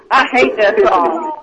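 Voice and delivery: usual speaking voice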